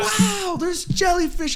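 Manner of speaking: very high, hammed-up awe; re-enacted amazement. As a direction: act out wide-eyed wonder way up high. Acting own amazement at jellyfish.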